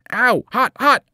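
loud, high, frantic yelps; pained yelling. A narrator's reading. Grover clutching the flaming whip, high frantic yelps. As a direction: yelp like fire scalds the hands. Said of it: "he yelled".

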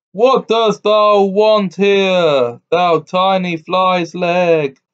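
loud, slow booming giant voice; a giant thundering at a tiny tailor. A narrator's reading. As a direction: boom it down from a great height. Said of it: "cried the giant, with a voice as if it were thundering".

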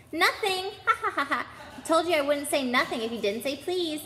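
high, crowing trickster voice with ha-ha laughter; gleeful taunt. A narrator's reading. Peeves to Filch after tricking him, gleeful taunt. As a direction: crow it, high and mocking.

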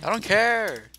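shouting. Real, not acted. Answering the game's voice.

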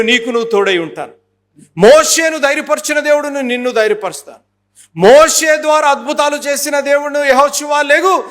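rising, forceful proclamation of God's words to Joshua; preaching God's promise. Acted. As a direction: declare it like God calling a leader. (దేవుని వాగ్దానం గట్టిగా ప్రకటించే స్వరం)

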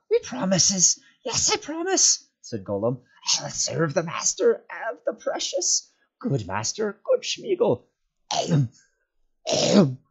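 high, eager creature voice ending in gulping coughs; fawning Gollum. A narrator's reading. Gollum fawning at Frodo's knees, promising to serve. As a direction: grovel and gulp eagerly.

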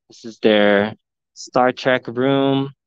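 ordinary talk

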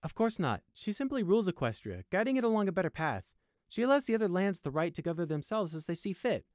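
confident loyal guard, lifted pitch. A narrator's reading. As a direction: explain it with easy conviction. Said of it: "Sunshine confidently replied".

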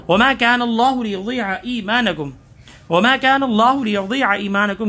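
teacher chanting the verse twice; Quran recitation. Shown as a demonstration. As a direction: recite each word cleanly, melodic line.

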